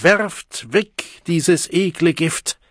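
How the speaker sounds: slow, high command; impassioned exhortation. A narrator's reading. The Savage urges Deltas to discard soma, calling out. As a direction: urge the crowd loudly.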